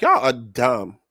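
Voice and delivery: low, flat unimpressed voice; deadpan mock verdict. Crowd judging Anna and Smiley, low and unimpressed. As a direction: say it like the whole room rolled its eyes. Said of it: "everybody looking at this like".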